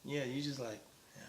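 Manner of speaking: soft, hushed mimicry of a partner; quietly imitating someone whispering; imitated whispering. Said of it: "Why are you whispering?".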